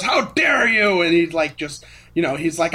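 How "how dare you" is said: loud, high, slow outraged demand; hammed-up indignant shout; indignant outburst. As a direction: bluster like a furious official. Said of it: "he just goes".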